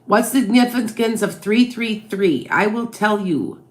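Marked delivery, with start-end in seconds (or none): none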